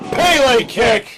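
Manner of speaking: slow high shout; excited call. Real, not acted. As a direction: draw the move name out loud. Live call of kick.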